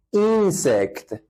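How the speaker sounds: اینسکت said very slowly, syllable by syllable; teacher slowly models insect; slow model word. Shown as a demonstration. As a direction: stretch اینسکت out with a clear short i.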